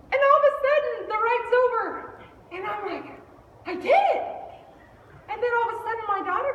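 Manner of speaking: high, loud rush of relief; worked-up shouting. Retold or imitated. Rider telling how it ended, worked-up shouting. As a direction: keep yelling as if still on the ride. Carries on breathless after the scream.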